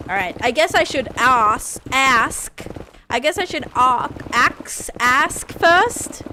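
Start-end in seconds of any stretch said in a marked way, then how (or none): 1.2-2.7 "ask" reshaped toward a short flat a; attempted American ask. Shown as a demonstration. Streamer attempts the American way, "ask" with a short a. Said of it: "I don't say it the American way". 4.3-5.5 "ask" slipping into "axe", then corrected; fumbled American ask. Shown as a demonstration. Streamer tries the joke's American word, "ask" fumbled as "axe". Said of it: "I can't say it".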